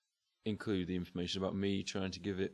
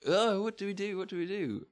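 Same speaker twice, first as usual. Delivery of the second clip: panicked; 11 semitones higher than usual. Retold or imitated. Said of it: "Was like".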